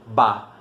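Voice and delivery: "ba" dito devagar e separado; sílaba ba. Shown as a demonstration. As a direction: pronuncie "ba" lenta e claramente. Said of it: "a consoante B mais a vogal A, que formam". ("ba" bem pausado, como sílaba de beabá)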